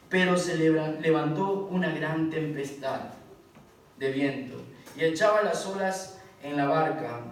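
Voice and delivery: scripture read loud and driving, like a sermon climax; lectura bíblica enfática. Acted. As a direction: proclaim the passage to the whole congregation. Lee Marcos cuatro con fuerza. (lectura de la Escritura, fuerte y enérgica)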